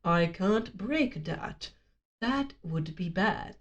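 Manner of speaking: slow, low, soft robot voice. Acted. Robot refusing the child's order, slow low robot voice. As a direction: drop low and space the words out like a bot. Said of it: "And the bot is like".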